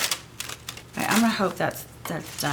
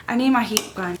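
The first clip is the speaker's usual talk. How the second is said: ordinary talk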